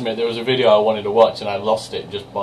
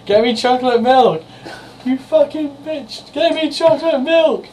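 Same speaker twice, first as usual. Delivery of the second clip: high, shrill-free mock kid yell at a much higher pitch; imitating a screaming kid; 4 dB louder than usual. Imitating the boy screaming at his mum for chocolate milk, high and loud. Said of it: "he started yelling at her".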